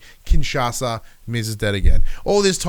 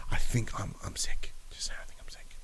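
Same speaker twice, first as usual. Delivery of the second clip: soft unvoiced confession; whisper; 3 semitones lower, 11 dB quieter than usual. Real, not acted. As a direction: whisper it like a secret aside. Joking aside about being sick.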